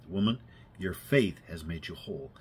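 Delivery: Jesus speaking softly to the healed woman; gentle. Retold or imitated. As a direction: say it gently to her. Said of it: "looked down at her so gently and said".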